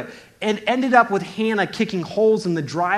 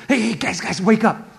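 higher, hurried rousing call; urgent wake-up call; 4 semitones higher, 3 dB louder than usual. Acted. Disciple waking the others on the boat, urgent call. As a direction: rouse sleeping friends, lightly acted.

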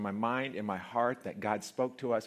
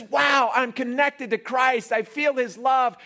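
awed believer; 13 semitones higher, 13 dB louder, slower than usual. Retold or imitated. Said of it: "you feel this".

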